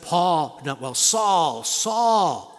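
calling the name out loud and solemn; a heavenly voice summons him by name; voice of God calling. Retold or imitated. Heavenly voice calls Saul, loud and solemn. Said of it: "that voice came and it said".

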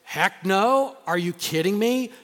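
voicing a listener's emphatic no; imitated exclamation. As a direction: shout it like an incredulous listener. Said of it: "you might say".

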